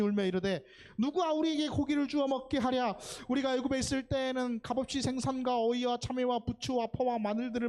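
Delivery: scripture reading. Real, not acted. Preacher leads the verse about craving meat, loud scripture recitation. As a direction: lead the congregation through the verse at full voice.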